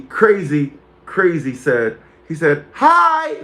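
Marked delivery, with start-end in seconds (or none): 2.7-3.4 high-pitched, teasing hello; high mock greeting. Streamer reads out a viewer's teasing comment, pitched high. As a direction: pop it out high.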